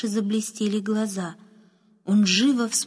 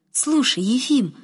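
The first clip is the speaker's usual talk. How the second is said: high, louder, delighted call for attention; joyful; 5 semitones higher, 5 dB louder than usual. A narrator's reading. Boy turns to the groom with news, joyfully. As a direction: call to him full of joy. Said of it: "радостно сказал он".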